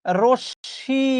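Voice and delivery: "রশ্মি" stretched into rosh-shi, no m; drawn-out correct pronunciation. Shown as a demonstration. Teacher introducing the word, "রশ্মি" slowly as rosh-shi. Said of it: "উচ্চারণ হচ্ছে".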